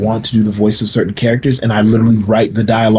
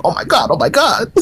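excited disbelief; 8 semitones higher than usual. Retold or imitated. Reacting to the agent call.

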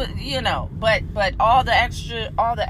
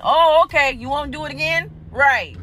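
normal talking voice

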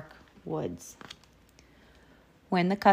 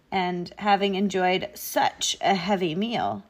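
plain reading voice

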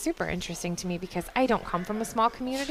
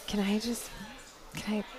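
soft breathy imitation of a hesitant request; 10 dB quieter than usual. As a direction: read it in a breathy hush. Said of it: "I didn't say it with a breathy voice like that".

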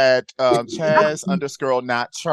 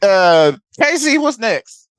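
louder, slow put-on Boston accent; 6 semitones higher, 5 dB louder, slower than usual. Host hurrying the co-host along in a mock Boston accent. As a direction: do a Boston guy asking what's next. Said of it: "I turned into Boston for a bit".

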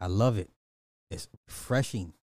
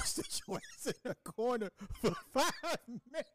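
laughing mock order; 10 semitones higher, 7 dB quieter than usual.

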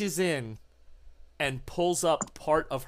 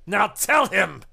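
higher and louder, raging command; furious shout; 5 semitones higher, 8 dB louder than usual. Acted. Arturo burns the creature and shouts at her, furious. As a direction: bark the order while torturing her. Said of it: "he says".

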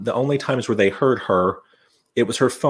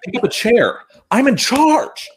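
high, louder mock commands of a take-charge heroine; commanding heroine; 12 semitones higher, 4 dB louder than usual. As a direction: play the unfazed final girl barking orders, hammed-up. Heroine barking orders, retold.

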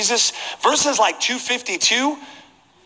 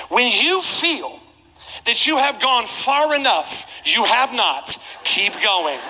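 drawn-out, pitched-up mock usher; slow, emphatic instruction; 2 semitones higher, slower than usual. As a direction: spell it out slowly for a slow listener. Usher sternly instructing him onward.

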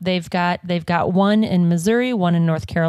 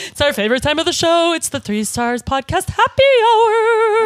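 announcer voice; 10 semitones higher, 3 dB louder than usual. Acted. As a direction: announce the happy hour segment. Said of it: "show intro redone as announcer".

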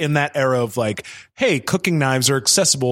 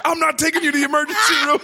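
high mock outburst of an angry dad; hammed-up angry father; imitating angry dad; 14 semitones higher, much faster than usual. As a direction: bark it like an exasperated father. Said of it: "he was so angry".

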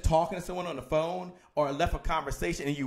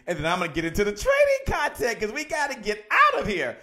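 raised, high, loud outburst; worked-up cry to get moving; playfully frantic; 8 semitones higher, 5 dB louder than usual. Real, not acted. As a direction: push it high and loud, as if losing it. Playfully frantic rush to start content.

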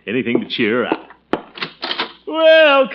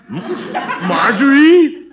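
slow, drawn-out high call up the stairs; calling upstairs; 13 semitones higher, 7 dB louder, much slower than usual. Acted. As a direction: stretch her name out to reach her room. Uncle calls niece upstairs.

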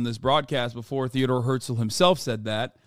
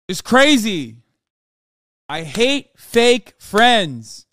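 slow, louder, every word hit; emphatic; 5 semitones higher, 6 dB louder, much slower than usual. Real, not acted. Streamer closing his rant, slow emphatic contempt. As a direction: spell it out with contempt.

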